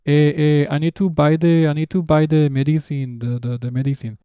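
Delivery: exaggerated Spanish-accented English, hesitant. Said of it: "I'm exaggerating for teaching purposes".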